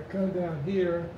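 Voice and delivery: ordinary talk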